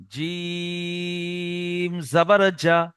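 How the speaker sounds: very slow, long-held letter name; measured, drawn-out spelling drill; chopped-up spelling. Shown as a demonstration. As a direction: spell it out piece by piece, stretching জিম. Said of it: "বানান করার সময় কেটে কেটে".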